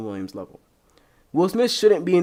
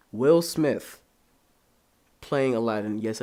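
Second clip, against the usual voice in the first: normal talking voice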